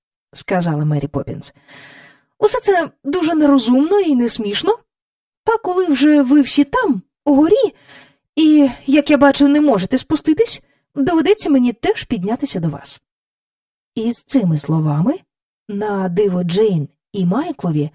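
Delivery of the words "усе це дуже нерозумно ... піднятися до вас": stern nanny voice, higher and brisk; a prim governess scolding, pace picking up; строга няня, вищим голосом; Mary Poppins. Acted. Mary Poppins speaks from the floor.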